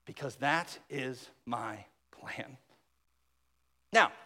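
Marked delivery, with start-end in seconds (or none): 0.1-2.6 whispered. Acted. God's plan voiced in sermon.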